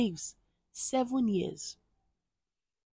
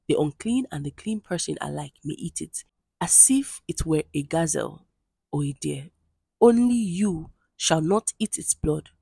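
steady, louder formal Bible reading; scripture reading; 5 dB louder than usual. Acted. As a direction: read the passage aloud to the congregation, measured and solemn. Reads scripture aloud, formal.

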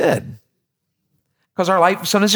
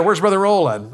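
ordinary talk, nothing marked

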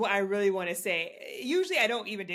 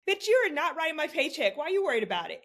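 retort to coworker; 7 semitones higher than usual. Retold or imitated. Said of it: "I'm just always like".

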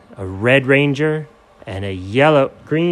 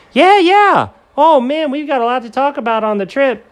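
high Doug; 13 semitones higher, 4 dB louder than usual. Acted.

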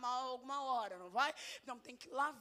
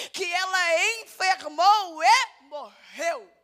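loud, slow Bible reading; loud scripture reading; 6 semitones higher, 15 dB louder, slower than usual. Real, not acted. Preacher reads Dorcas' story to the church, loud and slow. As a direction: proclaim the verses slowly and loudly.

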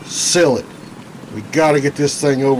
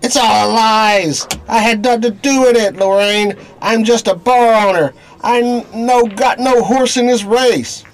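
panicked pleading; 9 semitones higher, 4 dB louder than usual. Acted. Bar owner panics, denies everything.

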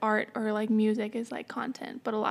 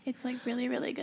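hushed whisper; 9 dB quieter, faster than usual. Real, not acted. Hushed praise of a show.

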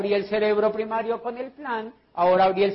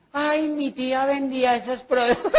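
imitated old lady; 5 semitones higher than usual. Meddling old lady interrupting the pitch, mocking imitation. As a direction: butt in as a hammed-up old lady. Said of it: "la cucha que diga".

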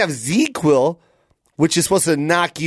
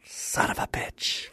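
whispered curse; 13 dB quieter, slower than usual. Retold or imitated. Foiled creep cursing.

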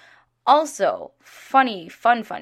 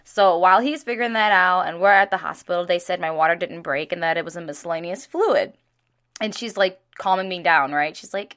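normal talking voice

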